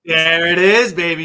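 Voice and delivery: loud, delighted outburst; excited. Real, not acted. Co-host's question lands as hoped, excited outburst. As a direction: pounce on it excitedly.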